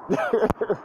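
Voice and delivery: brief real laugh to himself; short chuckle. Real, not acted. Speaker amused that the street matches school, short chuckle. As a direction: let out a small, amused chuckle.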